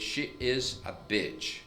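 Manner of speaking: slow, drawn-out "She is a bitch" with a long i in "bitch"; slow careful English. Shown as a demonstration. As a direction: spell out "She is a bitch" slowly, word by word.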